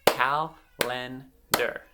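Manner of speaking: "Calendar" slowly split into three beats; syllable by syllable. Shown as a demonstration. Teacher giving children a word to blend themselves, syllable by syllable. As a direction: clap out "Calendar" with gaps between syllables. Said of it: "I'm gonna clap out".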